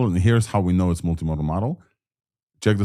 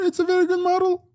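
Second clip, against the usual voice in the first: squeaky falsetto, far higher. Shown as a demonstration. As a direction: jump up into a tiny high voice.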